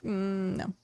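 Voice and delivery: soft, flat no; deadpan refusal. Retold or imitated. Answering the Paris fans, soft deadpan no. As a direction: shut it down dryly. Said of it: "And I'm like".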